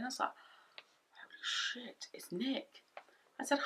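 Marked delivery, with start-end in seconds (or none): 1.1-2.8 stunned, hushed whisper; breathy aside of dread on spotting an ex; shocked whisper. Retold or imitated. Retold shock on seeing ex.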